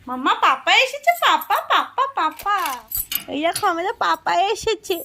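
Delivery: high, loud and thrilled, calling the little one. Real, not acted. Mother calls her toddler to see papa arriving, high excited call. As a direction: sing it out, papa is home.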